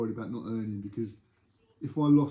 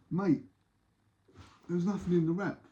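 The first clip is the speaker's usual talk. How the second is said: higher-pitched protest as a cheated buyer; acted complaint; 6 semitones higher than usual. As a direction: put on a pleading customer's voice. Cheated buyer confronts seller in story.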